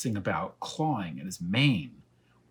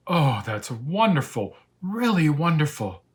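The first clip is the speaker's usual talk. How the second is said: delighted lion; 6 semitones higher, 7 dB louder than usual. Acted. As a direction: gush with joy over the braid. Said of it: "he was all smiles".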